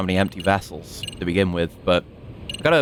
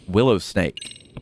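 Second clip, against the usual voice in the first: ordinary delivery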